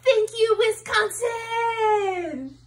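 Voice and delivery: milk the applause like a frontman; rock star thanks. Acted. The band thanking the crowd, hammed-up rock star.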